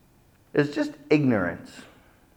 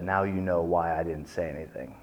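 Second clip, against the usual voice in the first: lower, much softer, pointed; low quiet menace; 8 semitones lower, 11 dB quieter than usual. Retold or imitated. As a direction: hush it with quiet threat. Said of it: "And Khrushchev said".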